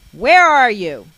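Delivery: loud, high searching yell; big hollered demand; angry shout. A narrator's reading. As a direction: shout it through the house as a furious mother. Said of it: "I hear her shouting".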